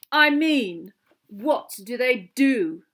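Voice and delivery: cold. A narrator's reading. Malfoy questioning the creatures' use, coldly. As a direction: press the question with slow disdain. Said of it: "said a cold voice".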